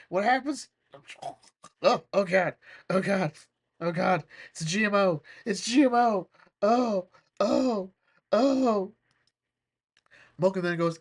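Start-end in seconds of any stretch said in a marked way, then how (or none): 1.8-8.9 higher, louder hammed-up dread; overacted horrified groaning; mock panic. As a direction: wail it like you've eaten something awful. Acts out Joey panicking over food.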